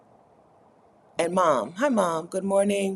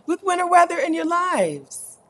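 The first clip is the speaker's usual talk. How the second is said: excited; 12 semitones higher, 4 dB louder than usual. Real, not acted. Mock despair over winter.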